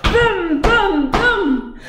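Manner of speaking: high, loud booms; booming footsteps. A narrator's reading. Narrator booms the giant landing. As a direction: slam out each boom.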